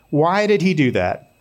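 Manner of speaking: ordinary delivery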